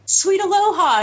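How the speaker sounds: high, chirpy mock greeting; playful imitation of a stranger's cheery hello; imitating the guy. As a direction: toss it off like an overly friendly stranger. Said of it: "he was like".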